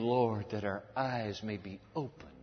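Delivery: low, soft, slow request; humble plea. Acted. Blind men asking Jesus for sight, humble plea. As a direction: ask it slowly and reverently.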